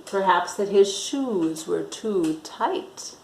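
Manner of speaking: ordinary delivery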